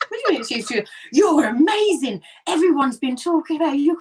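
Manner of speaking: high, loud, breathless praise; gushing fan. Retold or imitated. Worker telling Leslie everyone's talking about her, high excited gush. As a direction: rave as if she's a star.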